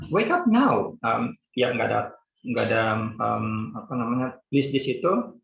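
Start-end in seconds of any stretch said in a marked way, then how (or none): 0.1-1.0 high, firm order; a little bit forceful order; forceful command. Shown as a demonstration. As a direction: push it as a command. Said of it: "ada little bit force di situ".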